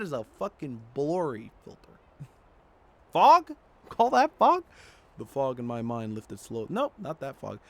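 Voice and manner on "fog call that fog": loud, high disbelieving yelp; incredulous. Real, not acted. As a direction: scoff at it in disbelief. Scoffing at the game's fog.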